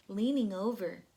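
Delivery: plain reading voice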